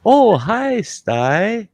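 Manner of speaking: high put-on woman's greeting. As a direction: chirp it up high like the wife. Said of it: "I hear this gal's voice go".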